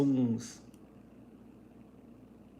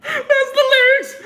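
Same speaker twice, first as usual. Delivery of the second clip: laughing squeal; 10 dB louder than usual. Real, not acted. Cracking up at own joke.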